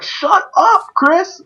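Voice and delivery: high-pitched squawked mock yell. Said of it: "they yell, like, at the top of their lungs".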